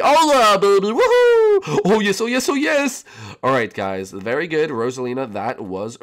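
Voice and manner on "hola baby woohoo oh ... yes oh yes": high, loud whooping celebration; excited Mario voice. Acted. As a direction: cheer like you just scored. Puppet Mario celebrates a point.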